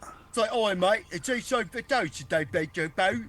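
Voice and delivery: high-pitched, garbled Cockney accent; mock Cockney. Shown as a demonstration. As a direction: have a rough stab at a Cockney accent. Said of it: "How would a Cockney accent sound?".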